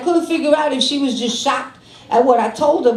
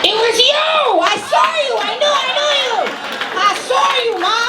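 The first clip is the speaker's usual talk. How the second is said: excited shouting; 11 semitones higher, faster than usual. Acted. Calling out to audience member.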